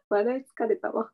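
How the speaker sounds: breathless after a laughing fit; after laughing hard. Real, not acted.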